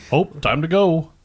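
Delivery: a lightly Midwestern sing-song; Midwest saying. Retold or imitated. Mocking Midwest slang, lightly Midwestern. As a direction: say it like a folksy Midwesterner. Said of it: "some of the".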